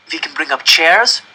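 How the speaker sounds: put-on high, eager child's voice selling the idea; eager pleading. Janek urging his parents to move into the coop, eager. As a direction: convince them, bursting with hope.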